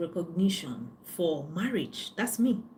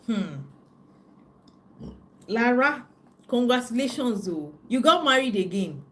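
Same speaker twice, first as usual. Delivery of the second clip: mock friend teasing; 4 semitones higher, 5 dB louder than usual. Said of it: "she was like".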